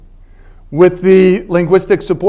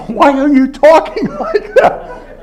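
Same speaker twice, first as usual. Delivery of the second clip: put-on girlfriend amused; 9 semitones higher than usual. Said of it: "And she said".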